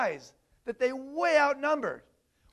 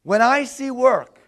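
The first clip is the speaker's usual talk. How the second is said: louder, slow model phrase to repeat; loud demo line; 2 semitones higher, 10 dB louder, slower than usual. Shown as a demonstration. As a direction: say it slowly and loudly so they echo it. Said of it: "I'm gonna say a phrase. I want you to respond".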